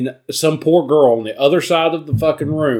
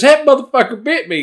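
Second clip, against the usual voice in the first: high shocked yelp; 11 semitones higher, 5 dB louder, faster than usual. Retold or imitated. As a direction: blurt it, stung and shocked. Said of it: "He spits it out and goes".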